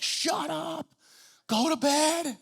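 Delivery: high-pitched mock complaint from the dorm; annoyed dorm mates. Dorm mates at three a.m. telling the arguers to sleep, high mock whine. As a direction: squeak it like sleepy neighbours griping.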